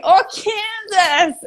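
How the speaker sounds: a shy, laughing attempt at an American "Kansas"; attempted American accent. Shown as a demonstration. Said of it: "the best I could do for an American accent".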